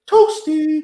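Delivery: high, squeaky game character; video game voice. Retold or imitated. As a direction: squeak it like the Mortal Kombat guy. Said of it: "that guy used to pop up in the corner and be like".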